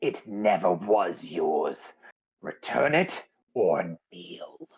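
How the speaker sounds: very slow, drawn-out villain voice; commanding cult leader. Acted. Cultist demanding the book back from Vale, slow commanding villain voice. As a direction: draw each word out as a solemn threat. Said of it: "he turns to you".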